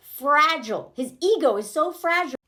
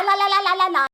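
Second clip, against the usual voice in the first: higher, louder babble of "lie"; mocking repetition; 7 semitones higher, 4 dB louder, faster than usual. Shown as a demonstration. Woman mocking how men lie outright, singsong babble. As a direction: rattle the word off like a la-la chant. Mocks men's constant lying.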